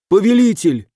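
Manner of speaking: high, urgent cry to his master; pleading. A narrator's reading. Mystic falls to his knees before the legate, pleading. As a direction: call out in anxious supplication.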